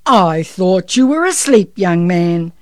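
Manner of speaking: booming, very loud Santa voice; big jolly Santa. A narrator's reading. Santa catches the boy awake, big and jolly. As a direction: boom it out jovially. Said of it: "said Santa's big jolly voice".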